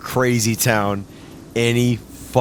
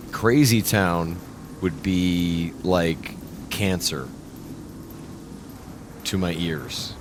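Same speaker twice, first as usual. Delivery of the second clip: slow disdain; 4 semitones lower, 5 dB quieter, slower than usual. Real, not acted.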